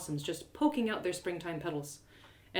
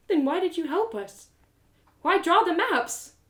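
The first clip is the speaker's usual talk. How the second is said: tearful; 10 semitones higher, 4 dB louder than usual. Acted. Tearful narrator questions Hatha.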